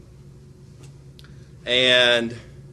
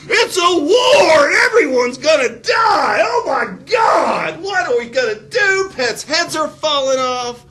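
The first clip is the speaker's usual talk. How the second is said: hammed-up screaming panic, much higher and louder; mock panic; 11 dB louder, slower than usual. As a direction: shriek like a hysterical crowd mocking the news. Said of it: "No, they said".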